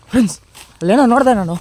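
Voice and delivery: loud and high "ಅಲ್ಲೇನೋ ನೋಡಿದೆ ನಾನು" in a fright; startled shout. Real, not acted. Ghost hunter tells his viewers he saw something, shouting scared. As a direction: blurt out what you saw, loud and scared.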